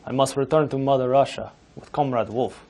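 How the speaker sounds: hammed-up Soviet comrade in a Russian accent; lightly put-on Russian accent; mock Russian accent. Mock Russian anchor persona.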